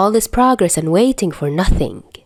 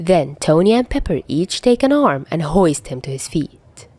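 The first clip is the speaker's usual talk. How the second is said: ordinary delivery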